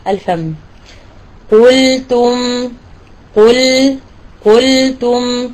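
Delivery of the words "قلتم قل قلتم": قلتم said slowly, lips spread on the silent ل; careful model of قلتم; slow correct demo. Shown as a demonstration. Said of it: "أرجع إلى بسط الفم".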